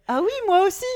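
loud, high-pitched mock cartoon enthusiasm; Dora. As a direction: overdo the kids' show heroine's eager reply. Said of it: "Et après, elle fait". (imitation criarde et surjouée de Dora)

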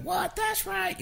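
very high, soft squeaky interjection; silly ad lib. Shown as a demonstration. Songwriter demonstrates the demo's stupid little ad libs, high silly voice. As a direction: squeak it out like a goofy background ad lib. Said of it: "all these little ad libs like".

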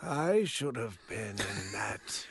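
slow, very soft whispered grumble; mock resentful whisper. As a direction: whisper it as the jealous brother. Playing the resentful brother.

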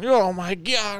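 high-pitched mock moan; imitated moaning yell. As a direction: wail it like a hurt friend, exaggerated. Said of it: "he's yelling and, and moaning".

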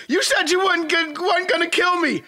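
mock panicked henchman dangling; panicked villain. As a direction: beg from the cliff edge, voice up high. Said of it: "Schwarzenegger hanging dude over the freaking cliff".

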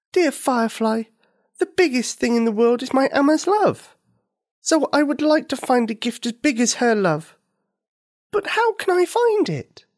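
put-on high, loving little girl, wondering; a child speaking of her mother's love. Nikini answers the firefly.

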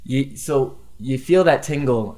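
ordinary talk, nothing marked

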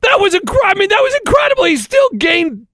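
high, fast, incredulous; can't believe what he saw; real astonishment; amazed. Real, not acted. Amazed at the backwards run.

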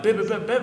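high vocal beeps standing in for notes; imitated riff beeps. Bandmates trading riff ideas over Messenger, high imitated beeps. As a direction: beep the riff out with your voice. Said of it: "And just be like".

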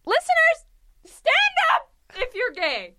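high, loud call to the crowd; loud high rallying cry; rallying shout. Pretend. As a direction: whip up an imaginary crowd. Calling out to listeners.

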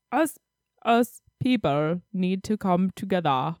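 put-on slow Peter Dinklage style British accent. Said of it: "trying to see if I could speak in a British accent".

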